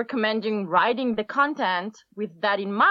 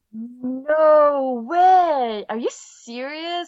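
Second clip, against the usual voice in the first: sarcastic amazement; 4 semitones higher, 5 dB louder, much slower than usual. Acted. Sarcastic awe at obvious advice.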